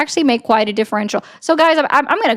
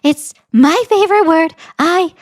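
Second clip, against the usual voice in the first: Donald Trump impression; 4 semitones higher than usual. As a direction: parody the boast in a raised put-on voice. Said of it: "That's Donald Trump".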